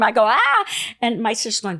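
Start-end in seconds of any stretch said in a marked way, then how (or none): 0.2-0.6 short high excited scream; quick yelp of a scream; retold scream. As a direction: imitate a startled fan scream, loud and high. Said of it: "they see me and scream".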